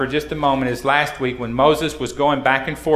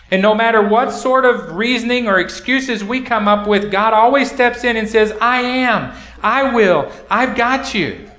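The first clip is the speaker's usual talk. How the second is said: higher, louder preaching build; 7 semitones higher, 3 dB louder than usual. Real, not acted. Pastor assures the congregation of God, fervent preaching. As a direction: rise up and proclaim it.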